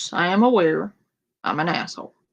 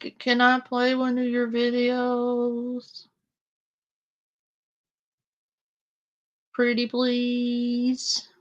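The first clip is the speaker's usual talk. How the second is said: higher, slow, drawn-out pleading; coaxing plea; 5 semitones higher, 3 dB quieter, slower than usual. Real, not acted. Host asking Ghost to allow playing a video, coaxing plea. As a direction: wheedle sweetly, stretching the last words.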